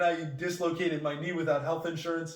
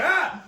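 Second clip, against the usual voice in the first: short, loud, high cry; mock pain yelp; 12 semitones higher, 8 dB louder than usual. Patient as knee is slammed back, imitated yelp. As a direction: yelp as if a joint just popped.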